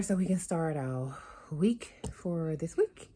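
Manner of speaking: usual speaking voice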